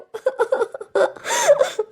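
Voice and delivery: a high, broken sobbing burst; high sobbing wail. Acted. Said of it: "ऐना फूट फूट कर रोने लगती है।". (ऊँची आवाज़ में फूट-फूट कर रोना)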